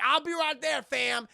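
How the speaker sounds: loud, high hyped-up shout; hammed-up eager shout; excited yell. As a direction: yell it like you're already on your way. [name] voiced excitedly.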